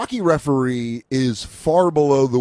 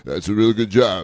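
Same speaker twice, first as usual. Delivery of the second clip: lower, offbeat mock Stallone; mock coked-up Stallone; 4 semitones lower than usual. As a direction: mumble it like a dazed, wired boxer. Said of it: "coked up Sylvester Stallone".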